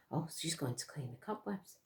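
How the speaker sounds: ordinary delivery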